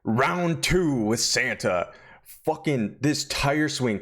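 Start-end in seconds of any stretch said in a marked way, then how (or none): 0.0-1.9 high ding then slow announcer call; lightly voiced fight announcer; ring announcer. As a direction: boom it like a boxing announcer. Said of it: "all you hear is".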